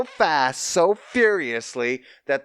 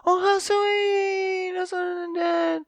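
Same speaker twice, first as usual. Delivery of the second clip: high, slow, sugary mock gush; slower than usual. Mocking the show's father and son premise, high gushing coo. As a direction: coo it like a sappy fan, drawn out.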